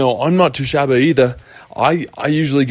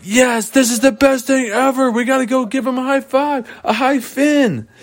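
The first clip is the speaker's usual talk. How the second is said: much higher, giddy celebration; overjoyed cheering. Acted. Patrick and Tommy rushing to congratulate Lars, much higher and overjoyed. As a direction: squeal with delight.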